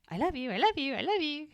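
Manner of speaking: high singsong lovey-dovey imitation; mock gushing. Podcaster teasing a couple whose love yous come freely, mock gushing.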